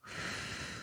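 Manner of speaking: soft long blow; blowing out candles. Acted. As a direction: puff out the candles. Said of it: "Help blow out the candles".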